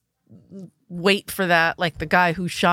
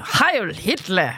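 put-on German accent; much slower than usual. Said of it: "I can't think of a German accent".

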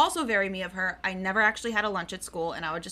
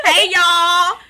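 loud, high Southern holler; 7 semitones higher, 11 dB louder than usual. Pretend. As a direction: echo a hammed-up Texan greeting. Copies friend's hick greeting.

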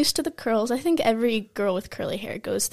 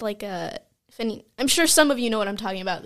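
ordinary talk, nothing marked

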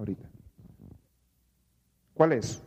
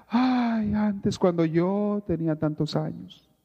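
mock wistful sighs on every "ay", pitched higher; nostalgic sighing; 5 semitones higher than usual. Preacher mimics a nostalgic churchgoer, sighing wistfully. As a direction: ham up a homesick old-timer pining for his village. Person longing for the past.

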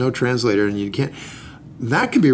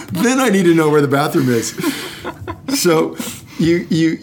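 laughing; 4 semitones higher than usual. Real, not acted. Joking while laughing.

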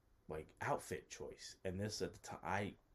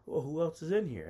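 high, louder acted-out question; imitating own surprise, pitched up; retold surprised question; 10 semitones higher, 9 dB louder than usual. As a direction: ask it high and loud, checking the room. Retold wary question on arrival.

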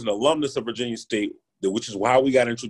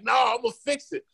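hell bent; 9 semitones higher than usual. Retold or imitated. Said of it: "hell bent".

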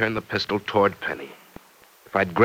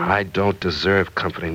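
usual speaking voice

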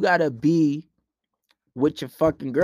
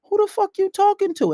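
very high-pitched confrontation; indignant checking. Retold or imitated. Man checking her a second time, indignant and high. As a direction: pitch shoots up in outraged challenge.

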